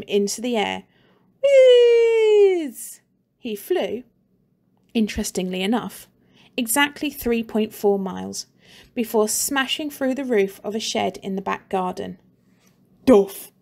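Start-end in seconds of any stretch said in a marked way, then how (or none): 1.4-3.0 high, loud whizzing noise; whizzing sound effect. A narrator's reading. Narrator voices the boy flying through the air, high whiz. 13.0-13.5 loud thudding crash noise; crash sound effect. A narrator's reading. Narrator voices the boy hitting the shed roof, loud thud.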